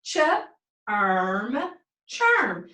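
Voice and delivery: segment ch, then erm, then blend into churn; sounding out a word. Shown as a demonstration. Teacher to class, sounds out churn slowly.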